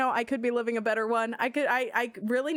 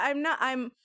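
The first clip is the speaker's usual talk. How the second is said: normal talking voice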